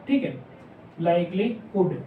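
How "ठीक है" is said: normal talking voice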